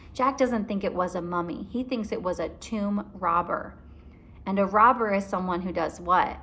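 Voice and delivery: usual speaking voice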